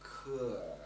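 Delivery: 可 drawn low through a deep dip; third tone. Shown as a demonstration. Teacher has students nod along, dipping third tone. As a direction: stretch 可 down and up like a nod. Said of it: "Nod your head like this".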